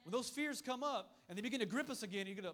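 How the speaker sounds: usual speaking voice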